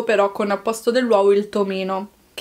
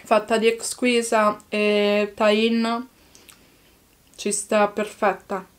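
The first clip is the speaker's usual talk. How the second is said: talking with food in mouth; speaking between bites; chewing while talking; slower than usual. Real, not acted. Seen 0: Holds burger, lips pressed and working between words after bites.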